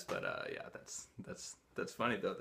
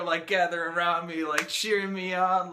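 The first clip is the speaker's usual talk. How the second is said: ordinary talk, nothing marked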